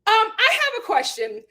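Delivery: imitating Doctor Nicole. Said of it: "Doctor Nicole was just like".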